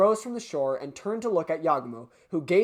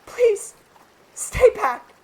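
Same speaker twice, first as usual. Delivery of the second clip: put-on much higher girl's voice, pleading; Hitoa's pleading voice; 13 semitones higher, 9 dB louder, much slower than usual. Hitoa in the sea, to Yagumo: high pleading cry. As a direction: beg him to stay away. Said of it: "I can't do her voice anymore".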